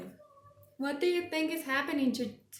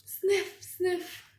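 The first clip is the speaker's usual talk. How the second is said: sniffing; 8 semitones higher, 3 dB louder than usual. Acted. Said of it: "says Gerald".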